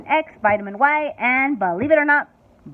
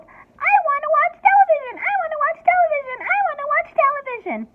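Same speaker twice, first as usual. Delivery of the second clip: much higher, shrill squeal; 3 dB louder than usual. A narrator's reading. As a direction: screech like a furious little boy. Child's tantrum continues.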